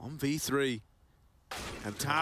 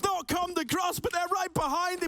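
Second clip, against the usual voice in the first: esports caster; 5 semitones higher than usual. Real, not acted. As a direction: hype caster building over music. Live play-by-play at round climax.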